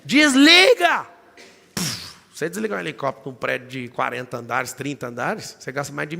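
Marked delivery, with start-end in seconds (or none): 0.0-1.1 very loud, much higher, slow drawn yell; desperate yell. Retold or imitated. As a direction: scream the order over the rotor. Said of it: "Eu falei". (grito desesperado e arrastado)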